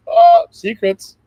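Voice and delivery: slow, high and loud, hammed-up mystery; mock mysterious. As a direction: draw it out like a spooky secret. Joking about revealing secret knowledge.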